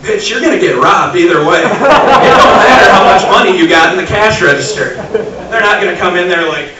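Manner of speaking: loud high-pitched exasperated rant; angry rant. Retold or imitated. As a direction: yell it at the cashier. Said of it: "I'm like".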